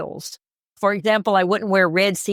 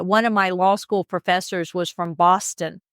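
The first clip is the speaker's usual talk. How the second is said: ordinary talk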